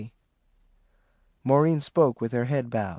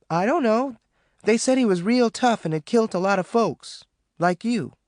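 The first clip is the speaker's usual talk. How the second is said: put-on young girl, higher and hesitant; young daughter, higher and tentative; Maureen voice; 9 semitones higher than usual. A narrator's reading. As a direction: ask her mama carefully, higher voice. Maureen explains.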